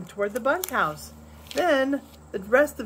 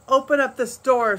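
loud answer; 5 semitones higher, 7 dB louder than usual. A narrator's reading. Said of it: "somebody answered".

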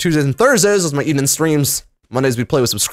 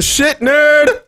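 taunting; 10 semitones higher, 3 dB louder than usual. Real, not acted. Taunting a player he robbed.

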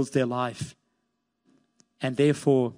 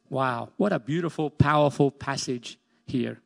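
ordinary talk, nothing marked

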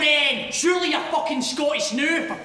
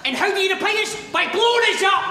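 raised, higher angry outburst; angry accusation; 4 semitones higher than usual. Acted. Accusing his rival's side of bombings, angry and raised. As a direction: fling the accusation at them. Heated accusation in sectarian quarrel.

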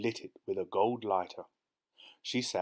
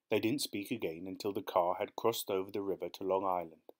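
plain reading voice

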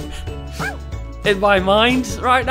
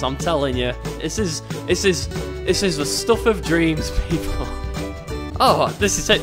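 ordinary talk

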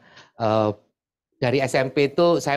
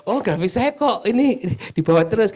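mock fretting; 10 semitones higher than usual. Participant imagined worrying that the graph will be shown around, mock fretting. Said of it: "Bukan gitu ya".

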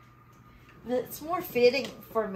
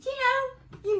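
squeaky; 8 dB louder than usual. Acted. Awkward squeal.

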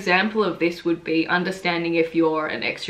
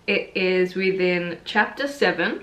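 usual speaking voice

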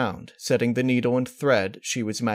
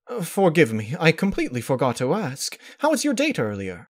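refined lady voice; 6 semitones higher than usual. A narrator's reading. Rarity voiced by narrator.

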